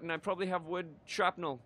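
ordinary delivery

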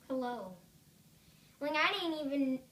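usual speaking voice

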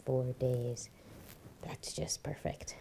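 low, dry sarcastic put-down. Acted. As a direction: sneer at it under your breath. Wife sneering at small income.

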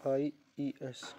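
"IES" drawn out letter by letter and falling at the end; slow spelling. Shown as a demonstration. Teacher spelling the plural ending slowly for pupils. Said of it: "क्या कर देंगे, IES".

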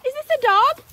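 ordinary talk

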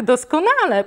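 mother's sarcastic first doskonale. Acted. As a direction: say doskonale with cold irony, first time. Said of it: "A tak".

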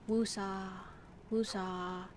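soft, drawn-out mellow chant; calming. Retold or imitated. Streamer imitating a biology teacher who calmed herself, calming. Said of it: "she had, like, a very calming voice".

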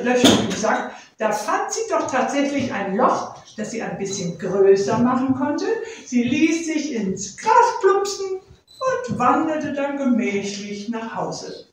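plain reading voice

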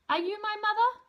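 a tiny chick asking, voice up high; high baby bird voice. Acted. Baby bird asks the kitten, high and hopeful.

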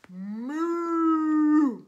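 long, loud moo; imitated cow mooing. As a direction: bellow a drawn-out cartoon moo. Produces the cow found in kitchen.

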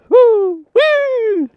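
very high, happy woo woo; excited whoops. Real, not acted. Arriving at a good camp spot, excited high whoops. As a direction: cheer like you just found the perfect spot.

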